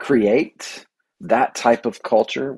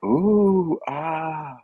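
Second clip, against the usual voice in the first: mock awed kids; 7 semitones higher than usual. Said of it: "at first I'm like".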